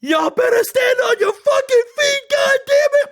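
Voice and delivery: high, loud arena shout at the crowd; wrestler shout. Pretend. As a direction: command the crowd to stand. Impersonating wrestler ordering crowd.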